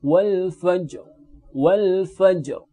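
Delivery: wal fajr stopped with a heavy final ra; thick ra. Shown as a demonstration. Teacher reciting wal fajr to the class: heavy ra. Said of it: "ini juga dibaca dengan tebal".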